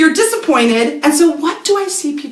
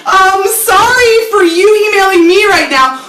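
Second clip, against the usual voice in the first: sarcastic annoyance; 7 semitones higher, 4 dB louder than usual. Retold or imitated. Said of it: "You know, like".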